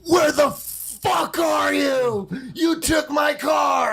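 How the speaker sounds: slow furious yell at friend; angry shout. Retold or imitated. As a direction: bellow it out, drawn out and angry. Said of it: "And he said".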